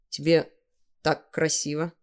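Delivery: put-on shy boy, pausing mid-phrase; bashful, halting. A narrator's reading. Seryozha blushes as he compliments Tanya, haltingly bashful. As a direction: falter over a mild, embarrassed compliment. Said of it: "тут запнулся и покраснел".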